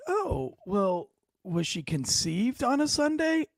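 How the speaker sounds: put-on high, drawn-out old lady voice; super old lady. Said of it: "this super old lady".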